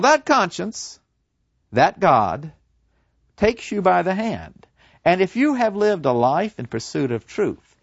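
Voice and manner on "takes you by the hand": ordinary delivery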